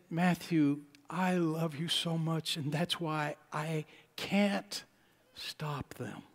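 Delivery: hushed, slow, choked-up tenderness; a father fighting not to cry; soft and breaking, near tears; holding back tears. Retold or imitated. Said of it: "trying to keep from crying".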